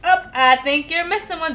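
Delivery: ordinary delivery, nothing marked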